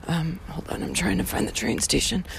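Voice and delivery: muttered aside. Real, not acted. Aside while walking, searching.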